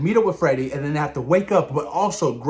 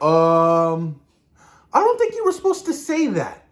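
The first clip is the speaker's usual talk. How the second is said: awkward alarm. Retold or imitated. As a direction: squeak out an uneasy correction. Acting out alarmed reaction to slip.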